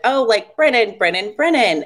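high, loud teasing chant of the name; teasing singsong. Retold or imitated. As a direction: taunt her with his name. Teasing friend about crush.

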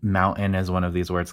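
ordinary talk, nothing marked

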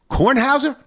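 high, loud questioning name; hopeful guess. Retold or imitated. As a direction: blurt the wrong name eagerly. Fan misnames him.

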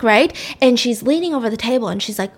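ordinary talk, nothing marked

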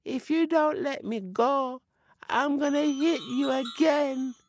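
high, plaintive spirit caught fast; high little voice of a stuck forest spirit; trapped spirit voice. A narrator's reading. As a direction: complain high and thin, then threaten. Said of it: "said the voice".

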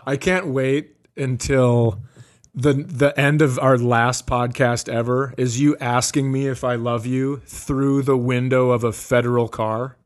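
usual speaking voice

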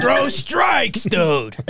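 high-pitched heckling shout, dropping on "dude"; dumb Yankee fan. Acted. Host mocks dumb fans heckling the pitcher, high shout. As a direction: holler like a clueless fan in the bleachers. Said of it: "you'll hear the dumb Yankee fans go".

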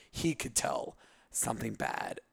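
ordinary delivery

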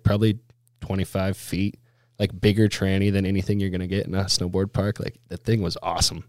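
ordinary delivery

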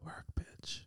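breathy whispered work bitch; whispered cheer. Acted. Contestant hypes a quiz answer in a whisper. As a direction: hiss it like a drag cheer.

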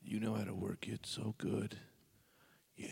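breathy whispered come-on; erotic whisper. Pretend. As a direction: whisper it seductively. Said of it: "pretend I'm getting a hand job".

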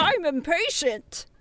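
put-on slow, creaky old man voice; a light old-timer grumble. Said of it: "said the one hundred and seventy-three-year-old man".

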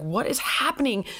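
ordinary delivery

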